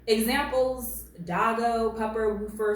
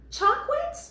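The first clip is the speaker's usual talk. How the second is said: very high, rising squeak of disbelief; incredulous echo. Real, not acted. Commentator appalled by a grown man's baby talk, incredulous squeak.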